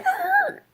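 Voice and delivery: very high squealed yelp; mock dog cry. Owner mimics dog at insulin time, mock cry. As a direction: imitate a small dog crying out in a high squeal. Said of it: "she cries".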